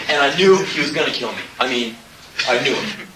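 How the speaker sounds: usual speaking voice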